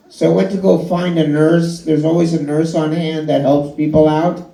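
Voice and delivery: normal talking voice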